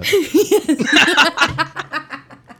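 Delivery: loud delighted laughter; high laugh. Real, not acted. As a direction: crack up laughing. Laughing at own callback.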